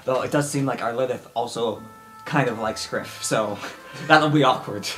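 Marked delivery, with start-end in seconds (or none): none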